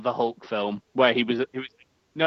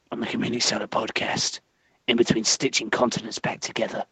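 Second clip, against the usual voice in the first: put-on deliberate tough-guy trailer voice in an English accent; Jason Statham; 3 semitones lower than usual. Plugging his next film as guest celebrity, deadpan English hard man. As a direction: play a terse action star, pausing between phrases. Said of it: "we're gonna have Jason Statham sell us on his next film".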